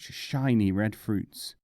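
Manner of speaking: usual speaking voice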